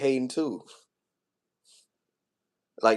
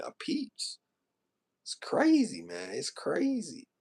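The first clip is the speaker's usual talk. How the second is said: very high voice, close to breaking; real upset, high and thinning out softly; emotional; 10 semitones higher, 6 dB quieter, slower than usual. Real, not acted. Venting to viewers about being judged, emotional. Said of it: "I'm about to get emotional".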